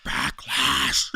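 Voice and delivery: mocking impression, low and winded; Triple H. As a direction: lightly imitate a breathless promo line. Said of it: "bro sounds so".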